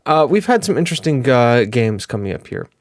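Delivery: ordinary talk, nothing marked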